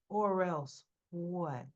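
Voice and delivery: slow, low, measured dare; imitating an unimpressed older brother; menacing challenge. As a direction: call the bluff slowly. Older brother calls the bluff.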